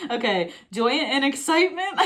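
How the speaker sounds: high-pitched reading breaking into laughter; giddy laughing. Real, not acted. Reader too giddy to read the card, laughing. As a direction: lose composure happily on the last word.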